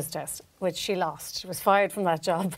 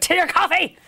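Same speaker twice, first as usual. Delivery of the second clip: rude stewardess; 10 semitones higher, 7 dB louder than usual. Retold or imitated. Mimicking rude stewardess sister.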